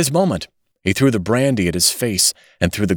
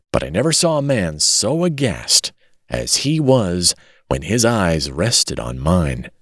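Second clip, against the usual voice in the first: plain reading voice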